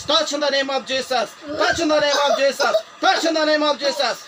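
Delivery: slow, loud, high command; forceful faith-healer cry; deliverance shout. Real, not acted. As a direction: stretch each word out at full force.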